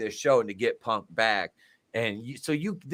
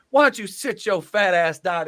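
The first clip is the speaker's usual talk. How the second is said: higher, louder crowd insult; playful imitation of a heel; mock trash talk; 9 semitones higher, 7 dB louder than usual. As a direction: jeer at a fan. Quoting Ernest Miller insulting fans.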